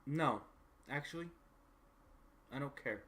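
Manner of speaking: start, stop, then give in and ask; lightly voiced visitor, reluctantly asking a favour; hesitant game character.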